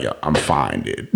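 low, soft, laid-back quote; imitated nonchalance. Retelling a shot friend reassuring hospital staff, nonchalant. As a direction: shrug it off quietly.

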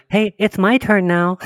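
put-on high little kid voice; whiny little kid asking. Kid tugging shirt, acted quote.

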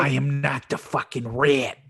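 hammed-up Boston accent impression; DiCaprio's Boston accent. Friend quoting Leo in The Departed, in a mock Boston accent. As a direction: imitate a committed but not-great Boston accent. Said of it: "commitment that DiCaprio has to his accent".

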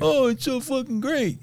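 high, fake-enthusiastic sneer; sarcastic mock gushing. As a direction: gush it with open sarcasm. Mocking fans of America.

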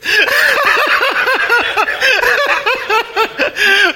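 sustained loud laugh, pitched high; delighted laugh at the happy ending; long high laugh. Real, not acted.

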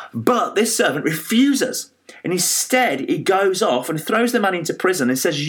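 ordinary delivery

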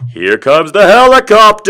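booming, hyped-up call; loud announcement. Real, not acted. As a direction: shout it like a stunt. Announcing his comic move.

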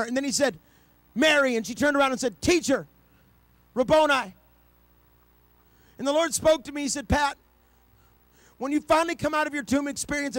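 high-pitched, impassioned pulpit delivery; full-force preaching. Real, not acted. Preacher urges people to get out of the tomb, full-force preaching. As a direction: drive it home to the congregation, building to a loud peak.

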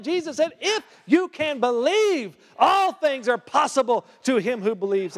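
high, ringing sermon voice; preaching. Acted. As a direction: proclaim it to the congregation. Preaching at full force.

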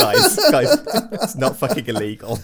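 high, loud protest through laughter; laughing protest. Retold or imitated. Podcaster mocking the claim that it's illegal, laughing protest. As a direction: laugh helplessly while objecting. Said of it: "keeps making me laugh".

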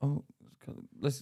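usual speaking voice

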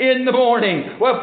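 ordinary talk, nothing marked

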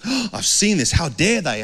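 a touch of staged righteous anger; mock outrage. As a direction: play a hammed-up furious Jesus. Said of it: "he's like".

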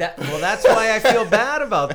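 loud laughing confession; laughing guiltily. Real, not acted. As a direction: laugh it out, embarrassed.

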